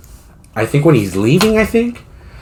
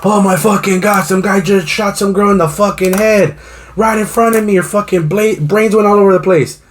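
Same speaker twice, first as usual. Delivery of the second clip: higher-pitched mock-panicked blurting; panicked livestream mimicry; 7 semitones higher than usual. Livestreamer telling viewers about a shooting, mock panic. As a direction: act out a freaked-out livestreamer.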